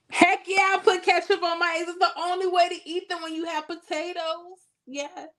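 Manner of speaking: high-pitched, emphatic burst of conviction; emphatic confession. Real, not acted. Streamer owning up to ketchup on eggs, high and emphatic. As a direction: blurt it out proudly in a high voice.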